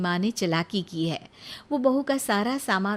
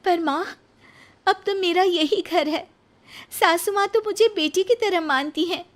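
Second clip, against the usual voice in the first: put-on higher, pleading young woman's voice; young daughter-in-law Nisha; 10 semitones higher, 4 dB louder than usual. Nisha answering her mother, pleading young voice. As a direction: speak as a gentle young bride.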